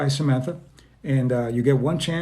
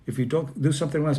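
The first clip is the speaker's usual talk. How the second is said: ordinary delivery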